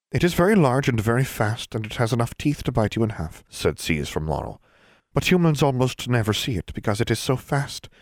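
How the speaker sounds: a prim little elf explaining his folk; proud elf. Acted. Elf Seas from Laurel speaking.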